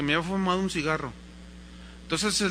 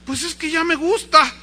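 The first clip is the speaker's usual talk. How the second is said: high, loud excuse; acted cheeky protest; defiant little boy; 10 semitones higher, 7 dB louder than usual. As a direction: answer dad back like a kid. Boy answering back to father.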